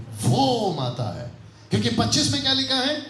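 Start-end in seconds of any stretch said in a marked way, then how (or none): 0.1-1.3 वो drawn out, falling to माता है; stress on वो. Shown as a demonstration. Teacher repeating for those who missed it, stretched वो. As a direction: lean long on वो, then drop. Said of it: "आप नहीं समझे?".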